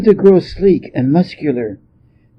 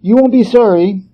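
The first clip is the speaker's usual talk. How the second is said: called out, higher and louder; calling back; 7 semitones higher, 4 dB louder than usual. A narrator's reading. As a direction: throw it back over the shoulder while being dragged off. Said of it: "he called back".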